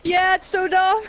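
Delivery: high laughing scoff. Real, not acted. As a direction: laugh it off as dumb. Laughing at dumb scene.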